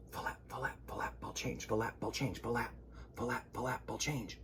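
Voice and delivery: whispered dance steps; whispered tap count. Acted. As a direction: hush out the tap rhythm. Tap dancing cow's jig.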